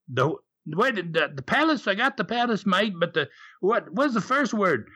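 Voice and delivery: lightly imitated Aussie driver, high and halting; Aussie cabbie accent. Cabbie who only got the word palace, mock Aussie accent. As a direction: stumble through it as a puzzled Australian cabbie. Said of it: "that Aussie accent".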